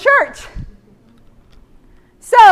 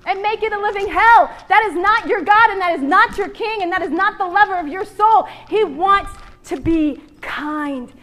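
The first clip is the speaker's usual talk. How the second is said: ordinary talk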